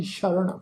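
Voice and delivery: ਸ਼ਰਨ opening on a clear "sh"; ਸ਼ਰਨ pronounced "sharan"; dotted sha sound. Shown as a demonstration. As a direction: say ਸ਼ਰਨ with "sh", not "s". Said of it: "ਸੱਸੇ ਪੈਰ ਬਿੰਦੀ ਲਗਾ ਕੇ ਪਾਠ ਉਚਾਰਨ ਕਰਾਂਗੇ".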